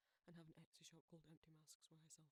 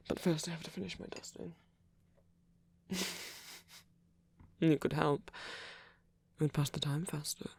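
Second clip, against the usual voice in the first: intimate whispered roleplay; 34 dB louder than usual. Acted. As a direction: whisper close and tender, like a shy caretaker.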